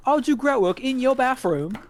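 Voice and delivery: a hint of a British mugger; mock British mugger. Retelling a comedian's British mugging insult, mock British. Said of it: "British insults when they mug you".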